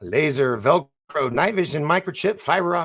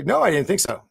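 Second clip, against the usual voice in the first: sharp dismissive no, raised; dismissive retort, a touch higher; snappy no; 6 semitones higher, 5 dB louder, faster than usual. Retold or imitated. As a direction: bite back a curt denial. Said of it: "are you just saying that as".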